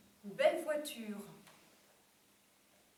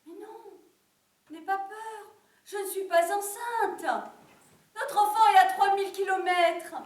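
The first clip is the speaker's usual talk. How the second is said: high-pitched reassurance, building louder; calm a panicking husband, high and increasingly emphatic; animated reassurance; 8 semitones higher, 13 dB louder than usual. Acted. Wife calms alarmed husband on phone. (rassurer vivement, voix aiguë qui monte en volume)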